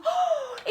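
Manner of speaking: startled gasp. Acted. Lion wakes startled.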